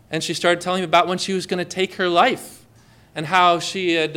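usual speaking voice

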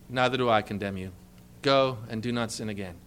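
low, soft and gentle, forgiving her; tender forgiveness. Acted. Preacher voicing Jesus releasing the woman, low and tender. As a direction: speak it low like a kind judge letting her go.

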